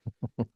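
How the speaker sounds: soft, restrained little chuckle; imitated polite laugh. Speaker imitating a reserved Helsinki audience, faint chuckle. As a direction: mimic a small ripple of muted laughter. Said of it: "like this".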